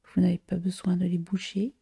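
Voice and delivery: ordinary talk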